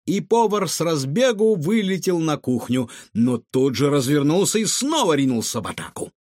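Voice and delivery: ordinary delivery, nothing marked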